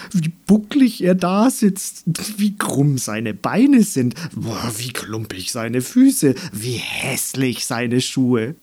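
a flower savouring each insult; jeering flower. Acted. Flower mocks the gnome. (genüsslich lästernde Blume)

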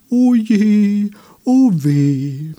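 high, loud voice of the pitying friends. Acted. The friends lament loudly for the tree. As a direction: wail along in loud sympathy. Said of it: "bedauerten ihn seine Freunde".

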